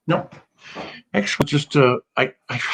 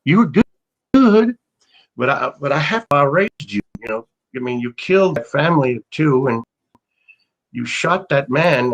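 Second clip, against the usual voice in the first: put-on mother's Southern accent; 3 semitones higher than usual. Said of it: "she's got a Southern accent".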